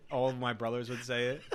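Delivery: ordinary delivery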